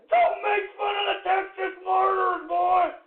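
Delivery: shout. Acted. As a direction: bellow it at the trolls. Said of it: "repeats the line quieter".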